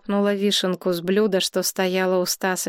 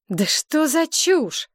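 high, drawn-out exasperation; irritated outburst; 8 semitones higher, slower than usual. A narrator's reading. Woman dismisses her drunk friend's nonsense, high and irritated. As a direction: pitch it up in irritation. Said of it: "раздражённо выпалила Галина".

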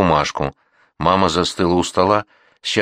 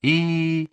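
high, soft sustained whine; thin keening on a held note; grandmother's drawn-out wail; 10 semitones higher, 4 dB quieter than usual. A narrator's reading. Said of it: "снова раздалось привывание бабы Насти".

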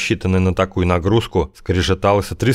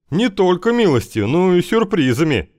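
higher, teasingly mysterious reply; hint at a secret surprise; 11 semitones higher than usual. A narrator's reading. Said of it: "загадочно ответил Кузьма".

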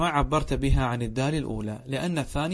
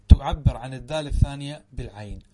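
ordinary talk, nothing marked

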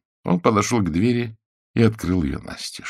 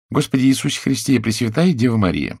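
high, pleading woman's voice; Chiara praying; 6 semitones higher, 3 dB louder than usual. A narrator's reading. Chiara nags the saints in a high wail. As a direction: complain to heaven in a high whine. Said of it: "говорила она".

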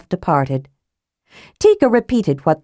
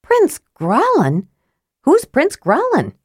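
puzzled; 9 semitones higher, slower than usual. Acted. Tika confused.

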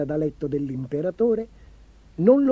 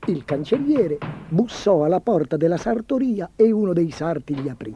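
ordinary delivery

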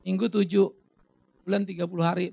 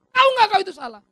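mock confrontation; 10 semitones higher, 7 dB louder, faster than usual. Acts out confronting the addict.

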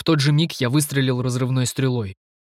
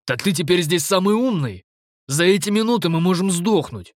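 high angry outburst; 5 semitones higher than usual. Acted. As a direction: lash out in protest. Stef argues under attack.

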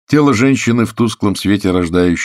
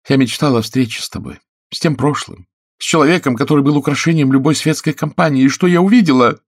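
high, stagy lament, building louder; theatrically tragic; 9 semitones higher, 5 dB louder than usual. A narrator's reading. Actress laments the man Oleg used to be, theatrically tragic. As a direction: play the wronged tragic heroine. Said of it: "В голосе её прозвучали театрально-трагические нотки".

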